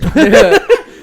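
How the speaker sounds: high shrieking laugh; loud laughter. Real, not acted. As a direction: burst out laughing. Big laugh.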